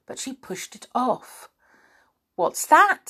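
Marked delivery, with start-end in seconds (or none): 2.3-3.1 high, loud startled question. Acted. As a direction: ask it in alarm. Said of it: "said Joe".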